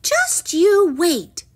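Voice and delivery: high, loud, drawn-out threat; slow, high-pitched defiance; defiant princess. A narrator's reading. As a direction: stretch it out as a cross princess. Princess defies her father.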